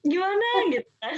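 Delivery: a teacher choking up over frightened pupils; choked up. Real, not acted. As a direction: voice catching, close to tears. Sad about crying children.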